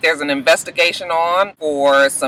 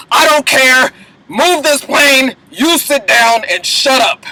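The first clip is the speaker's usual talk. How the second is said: louder, higher, slow bossy orders; forceful command; 7 semitones higher, 3 dB louder, slower than usual. Retold or imitated. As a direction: shut her down with authority. Fitz overrules Abby.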